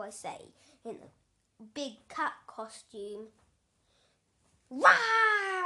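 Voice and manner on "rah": big loud mock roar, much higher. Boy in a cat costume roars at the fishmonger.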